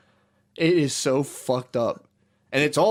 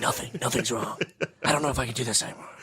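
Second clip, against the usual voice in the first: softer, distant mock girlfriend; lightly voiced cold girlfriend, fast at the end; icy girlfriend voice; 5 dB quieter, faster than usual. Mocking himself gone icy on a girlfriend, softer and distant. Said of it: "and be like".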